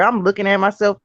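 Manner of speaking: ordinary talk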